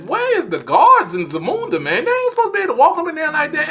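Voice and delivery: acting out his own outrage at the screen; indignant retelling. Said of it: "I'm like".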